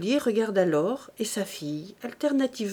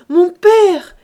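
very loud, high desperate cry; 8 semitones higher, 14 dB louder than usual. A narrator's reading. Daughter throwing herself on her knees to father, crying out. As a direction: cry out to stop him. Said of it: "cria Eugénie en se jetant à genoux".

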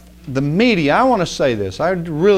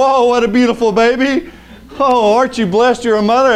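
mock cheerful newscaster; 6 semitones higher, 3 dB louder than usual. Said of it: "He wouldn't go".

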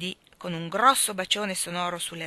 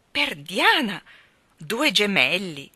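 astonished exclamation; 6 dB louder, slower than usual. Acted. Laurie astonished at twins.

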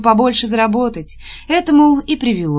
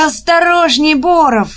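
high, loud cry of alarm; shouted warning; 8 semitones higher, 5 dB louder, slower than usual. A narrator's reading. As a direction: shout a warning at a clumsy man. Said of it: "закричала Нюра". (встревоженный крик)

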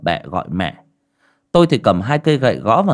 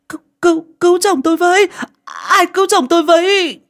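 very high, loud desperate cry for help; screaming for help; 6 dB louder, faster than usual. Acted. Mother calling neighbours to save her husband, desperate high scream. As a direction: scream for help at the top of your voice. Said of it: "Mẹ hét lên".

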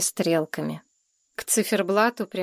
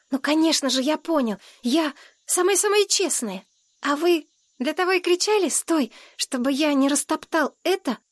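put-on high, excited child's voice; eager little boy; 7 semitones higher than usual. A narrator's reading. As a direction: gush earnestly like a small boy.

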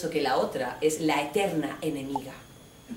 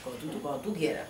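put-on sulky boyfriend; 6 semitones lower, 9 dB quieter than usual. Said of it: "Antonio's sulky reassurance".

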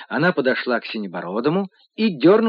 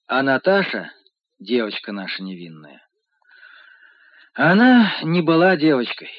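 plain reading voice

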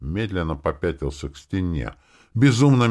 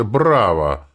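loud, drawn-out mock bravo; sarcastic; 4 semitones higher, 7 dB louder than usual. A narrator's reading. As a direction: applaud with heavy sarcasm. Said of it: "добавил сарказм в голосе". (громко, с сарказмом)

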